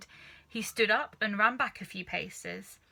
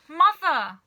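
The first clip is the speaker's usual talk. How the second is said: loud, high wail; 8 semitones higher, 12 dB louder than usual. Acted. Daughter wailing at her mother, loud and high. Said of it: "wailed Missus Cranky".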